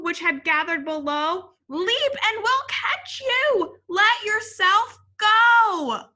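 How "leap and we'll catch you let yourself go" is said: high, loud, slow calling; a crowd calling up to Kevin. A narrator's reading. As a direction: coax him down, high and slow. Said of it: "the crowd called".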